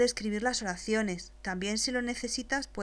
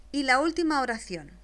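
ordinary delivery

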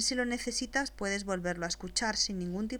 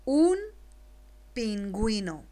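dictating word by word; 4 dB louder than usual. Shown as a demonstration. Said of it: "después te la dicto".

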